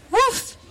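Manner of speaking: very high yappy bark; dog bark. Acted. Dog skeleton barking at the dinosaurs, very high bark. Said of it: "barked at the dinosaurs".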